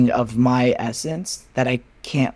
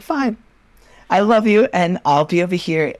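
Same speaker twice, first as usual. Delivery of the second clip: a higher, huffy mock "Fine"; imitating her own pouty brush-off, pitched up; passive-aggressive fine; 6 semitones higher, 3 dB louder than usual. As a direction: sulk it off with a forced sweetness. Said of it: "passive aggressive as fuck".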